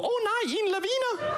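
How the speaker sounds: very high, mock panicked cry; Danish friend's alarm. Mountaineering friend spotting an avalanche, very high mock alarm. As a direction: squeal it out like a startled Dane. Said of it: "one of them will just point and go".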